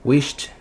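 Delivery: "wished" as wisht, one syllable. Shown as a demonstration. Teacher showing an ending that doesn't vibrate, "wished" with T. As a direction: close "wished" on a voiceless T. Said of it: "com som de T de tatu".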